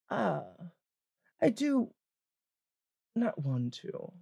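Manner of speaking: high, halting, deliberate character voice; hesitant older woman picking her words; old adventurer woman. Acted. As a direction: deliver a warning reluctantly, with long pauses. Old adventuress gives halting warning.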